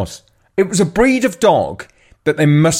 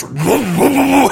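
mock yapping, climbing higher; imitated small dog bark; 8 semitones higher than usual. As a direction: yap like a tiny dog squaring up. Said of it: "She just goes".

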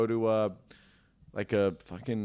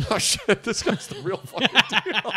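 high, loud laughing outburst of amazement; 11 semitones higher, 4 dB louder than usual. Pretend. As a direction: burst out laughing in disbelief. Acted reaction, laughing out loud.

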